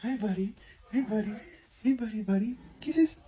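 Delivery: squeaky doggy talk with kissy sounds. Real, not acted. Cooing to the puppy.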